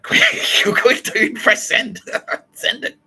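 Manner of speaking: high, loud, laughing hurry-up; breathless giggling panic; frantic urging. Retold or imitated. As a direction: push him to hit send now, cracking up. Kitty urging him to send.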